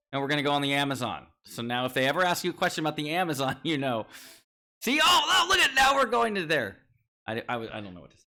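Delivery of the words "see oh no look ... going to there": high excited yell; excited shout. Real, not acted. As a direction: blurt it out, pitched way up. Shouting at map change.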